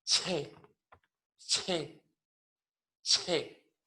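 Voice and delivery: "che" con soplo de aire; CH aspirada. Shown as a demonstration. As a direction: suelta el "che" soplado. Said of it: "la C H aspirada". (CH aspirada)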